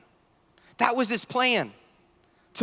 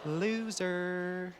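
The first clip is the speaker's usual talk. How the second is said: self-mocking aside; 2 semitones lower, 10 dB quieter than usual. Real, not acted. Mocks himself after dumb question.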